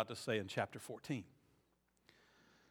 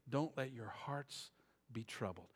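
tender; 3 semitones lower, 7 dB quieter, slower than usual. Shown as a demonstration. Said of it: "quiet tender reading".

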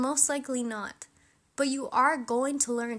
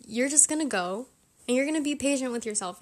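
ordinary delivery

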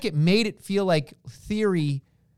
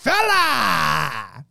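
catchphrase shout. Retold or imitated. Host bursts out with the wrestler's catchphrase. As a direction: bellow it like a brawling Irish wrestler.

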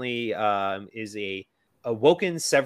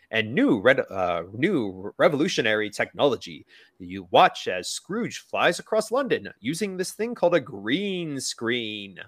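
movie trailer announcer; 4 dB louder than usual. Retold or imitated. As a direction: hype it like an old trailer narrator. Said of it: "for the movie was like".

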